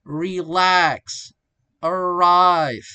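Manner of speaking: "relax", "arrive" slow, second syllable loud and long; second-syllable stress. Shown as a demonstration. Teacher illustrating verb stress, "relax" and "arrive" stressed on the second syllable. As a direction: stretch LAX and RIVE. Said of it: "usually on the second syllable".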